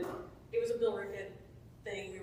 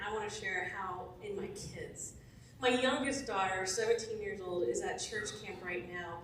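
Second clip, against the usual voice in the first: normal talking voice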